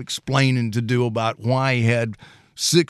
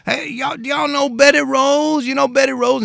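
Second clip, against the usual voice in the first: high, loud call to the porch; hollering out; 5 dB louder than usual. Retold or imitated. Dad calling out to people on the porch, high and loud. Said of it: "he'd holler and say".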